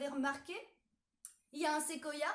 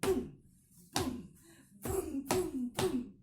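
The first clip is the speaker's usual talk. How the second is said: imitated stomping; 2 semitones higher, 5 dB louder, slower than usual. Shown as a demonstration. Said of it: "On tape des pieds".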